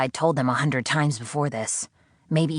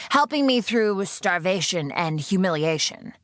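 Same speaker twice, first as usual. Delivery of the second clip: higher, dry falling Right; sarcastic; 5 semitones higher than usual. Acted. Sydney mocking her captor's claim, dry sarcasm. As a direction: drip with sarcasm.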